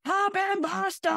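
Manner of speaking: high, sing-song birthday call; cheery cast member. Retold or imitated. Imitating a cast member calling across the pool, high and cheery. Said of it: "then the guy goes".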